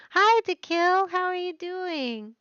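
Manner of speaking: loud happy hi; excited greeting. Real, not acted. Greeting another viewer.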